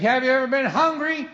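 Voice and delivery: dad pressing his point; loaded question. Retold or imitated. Dad asking his son from the fridge, high and loud.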